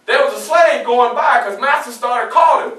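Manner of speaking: normal talking voice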